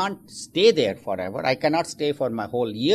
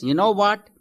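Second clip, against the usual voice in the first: indignant complaint; 3 semitones higher, 4 dB louder than usual. Acted. Husband blames wife, punchline.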